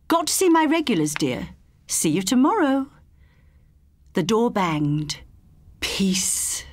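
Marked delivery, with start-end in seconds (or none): 0.1-2.9 called over shoulder. Acted. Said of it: "hurling her last words over her shoulder". 5.8-6.6 breathy relieved whisper of a sigh. Acted. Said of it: "sighed Eva".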